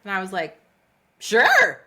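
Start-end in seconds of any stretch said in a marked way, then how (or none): 1.2-1.8 much higher and louder, a bright mock-eager yes. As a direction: jump at the offer, hammed-up eager. Eager acted reply to inbox.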